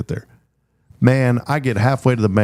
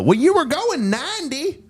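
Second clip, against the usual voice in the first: Roscoe the cop. Retold or imitated. Texas cop greeting the speeding driver, in a very high voice. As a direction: squeak it out like an old small-town sheriff. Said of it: "He sounded just like that".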